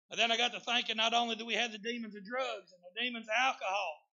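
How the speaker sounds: loud, emphatic sermon delivery; full-force preaching. Real, not acted.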